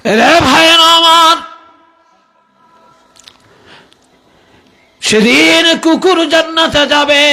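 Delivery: high, ringing preacher's cry; impassioned calling out. Real, not acted. Preacher calls out to the congregation. (উঁচু গলায় ওয়াজের ডাক)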